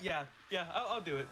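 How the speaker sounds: ordinary talk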